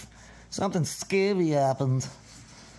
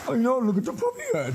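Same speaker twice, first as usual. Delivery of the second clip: puppy talk; 12 semitones higher than usual. Real, not acted. Owner gushing at the puppy, high coo. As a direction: gush over the dog up high. Cooing at the dog.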